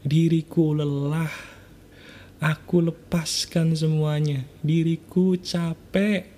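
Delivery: guided affirmation. Acted. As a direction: let each phrase fall slowly, like surrendering. Leads a spoken self-apology.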